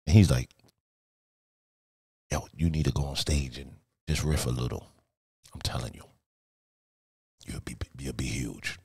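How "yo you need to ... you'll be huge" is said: hushed, confidential retelling; quiet quoted advice. Retold or imitated. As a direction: say it low like a secret. Quoting Stan's advice softly.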